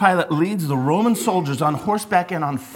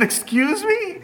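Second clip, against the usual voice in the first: high laughing excuse me?; laughing disbelief; 14 semitones higher, slower than usual. Retold or imitated. Retelling his reply to Julie's dad, high and laughing. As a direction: squeak it out through a laugh. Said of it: "I said".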